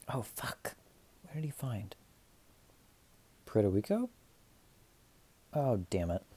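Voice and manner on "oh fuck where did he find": whispering to self. Real, not acted. Forgot fact, muttering to self.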